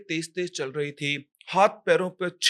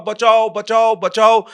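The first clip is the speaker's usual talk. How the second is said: louder, higher mock shouting; acted cry for help; 6 semitones higher, 10 dB louder, slower than usual. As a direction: call out for rescue, narrator acting a victim. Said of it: "पूरी ताकत से चिल्ला रहा था". (मदद के लिए चिल्लाने का अभिनय)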